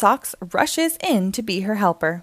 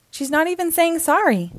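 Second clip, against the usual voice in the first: mild upset cry; 9 semitones higher than usual. Acted. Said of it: "cries Bluey".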